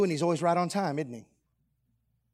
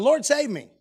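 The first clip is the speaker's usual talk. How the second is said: louder, higher cry for rescue; 7 semitones higher, 5 dB louder than usual. Acted. Sinking Peter calling to Jesus, crying out. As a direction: cry out for help. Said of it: "he cried out".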